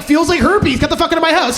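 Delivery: mock furious yell at the boyfriend; mock outrage. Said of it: "I'm like".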